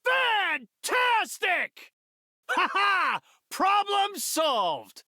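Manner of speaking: high, drawn-out victory cry; gleeful hero shouting in triumph; triumphant shout. Acted.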